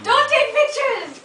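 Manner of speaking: high, loud, slowed call to stop the photos; playful shout. Real, not acted. Storyteller shouting at friends not to take pictures, playful loud call. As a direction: yell it out half-joking, drawn out.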